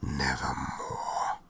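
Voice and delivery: low, very slow, otherworldly croak; raven's answer. Acted. Raven answering the narrator, low, slow and eerie. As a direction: let the raven toll the word slowly and low. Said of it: "Then the bird said".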